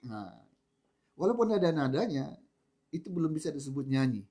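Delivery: normal talking voice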